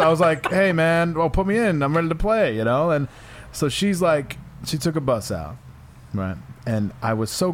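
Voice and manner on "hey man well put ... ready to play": eager excited. Retold or imitated. Eager quote of himself.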